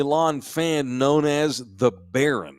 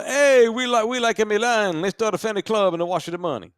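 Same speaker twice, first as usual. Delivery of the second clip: put-on Italian mobster accent; 9 semitones higher than usual. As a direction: play it like a Italian mafioso. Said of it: "That's my Italian mobster accent".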